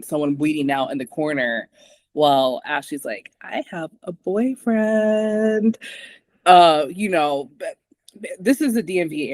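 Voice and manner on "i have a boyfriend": slow, higher, dreamy mock swoon. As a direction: drawl it like a lovesick girl. Mocking lovestruck character.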